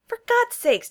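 loud, high burst of exasperation; exasperated. Acted. Jane to evasive Darcy, exasperated outburst. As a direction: burst out, fed up with the dodging.

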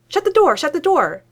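high, loud panicked yell; urgent shout. A narrator's reading. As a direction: yell it before she escapes.

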